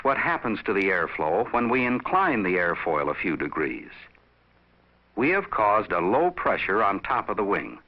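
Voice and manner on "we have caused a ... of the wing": usual speaking voice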